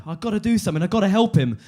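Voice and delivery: ordinary talk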